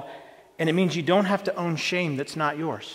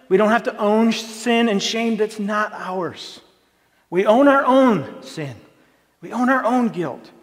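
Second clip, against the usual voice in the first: emphatic preaching; 5 semitones higher, 5 dB louder than usual. Acted. Preacher stresses the freedom.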